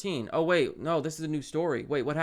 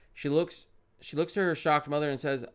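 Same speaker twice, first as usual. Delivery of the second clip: ordinary delivery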